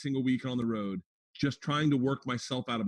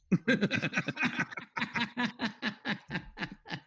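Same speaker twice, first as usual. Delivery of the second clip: high laugh; 10 semitones higher than usual. Real, not acted. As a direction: laugh at the joke. Laughs at the Billy Burr joke.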